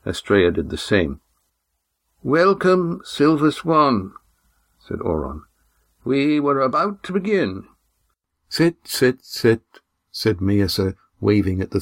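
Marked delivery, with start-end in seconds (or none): 2.2-4.1 put-on high, slow, ceremonious old man; formal old man. A narrator's reading. Old Oron welcomes the Silver Swan, formal. 6.0-7.6 put-on formal old man. A narrator's reading. Old Oron welcomes the Silver Swan, formal. 8.5-9.6 a hint of a brisk weary old woman; tired old woman. A narrator's reading. Miasa waving the three to sit, brisk.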